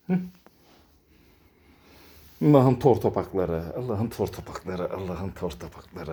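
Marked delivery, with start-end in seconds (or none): 3.8-6.1 much softer, lower, tender; soft murmured coo. Real, not acted. Murmuring fondly over the kittens, very soft. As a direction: murmur lovingly to the chubby kittens. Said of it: "repeated softer".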